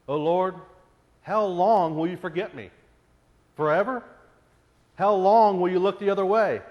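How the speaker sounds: loud anguished psalm reading; impassioned lament. Acted. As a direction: plead with God, almost shouting.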